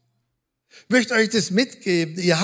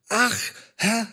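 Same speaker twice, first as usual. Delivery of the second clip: sighing; 3 semitones higher than usual. Acted. Sighing prayer voiced again.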